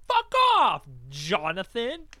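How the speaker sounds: sudden high, loud "fuck off"; yelled brush-off. Retold or imitated. Kid yelling at a pestering friend, loud and high. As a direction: snap back loud and high at a pest. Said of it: "I'm like".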